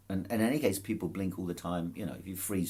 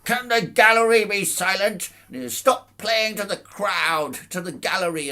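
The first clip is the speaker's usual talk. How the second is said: stern judge; 14 semitones higher, 11 dB louder than usual. Retold or imitated. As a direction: boom at the gallery like a pompous judge. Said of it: "goes, he goes".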